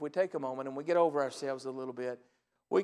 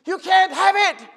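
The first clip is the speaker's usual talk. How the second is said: high, slow, much louder yelled refusal; retold shouting; 18 dB louder, slower than usual. Caller yelling the refusal down the phone, loud slow shout. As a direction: bellow it back at him like the caller did. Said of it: "he starts shouting through the phone".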